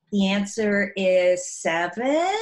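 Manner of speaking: rising at the end, as if unsure; questioning uptalk. Retold or imitated. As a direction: say the answer like a timid question. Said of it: "her voice would always go up".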